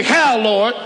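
rising, pressing sermon climax; preaching at full force. Acted. Pastor urging the congregation, in full preaching cry.

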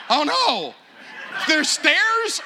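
high, loud incredulous question; hammed-up horror, pitch climbing to the end; mock dismay. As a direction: act out comic disbelief. Dismayed at seven flights of stairs.

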